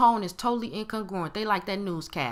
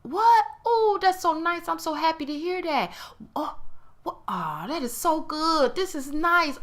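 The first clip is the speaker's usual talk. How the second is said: genuinely thrilled; 8 semitones higher than usual. Retold or imitated. Showing how a happy friend reacts to good news, thrilled. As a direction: gush like the news is the best thing ever. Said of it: "tone did not sound like".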